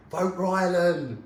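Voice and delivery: high, loud, slow chant-like call; retold shout. Retelling [name] calling out on stage, loud. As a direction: belt it like a pop star mid-song. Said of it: "he went".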